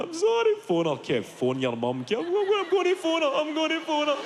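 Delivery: put-on high, whining drunk plea; a pathetic drunk promising his mum; high whimper of a drunk; whiny drunk self.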